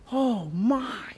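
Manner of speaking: moved near tears; tearful cry. Real, not acted. Overcome preacher.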